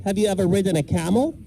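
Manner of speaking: normal talking voice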